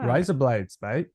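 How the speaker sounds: "rise up lights" run together as Australian "razor blades"; Australian accent. Shown as a demonstration. Trying his friend's accent trick, Australian accent. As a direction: try a playful Australian accent. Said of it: "Say it".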